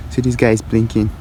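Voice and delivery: usual speaking voice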